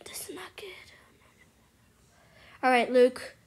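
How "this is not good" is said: higher worried whisper. Real, not acted. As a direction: whisper it again, pitching up with fear. Repeats it, scared.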